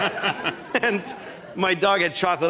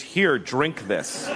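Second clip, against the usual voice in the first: slow coaxing; 3 semitones lower, much slower than usual. Retold or imitated. Coaxing the dog.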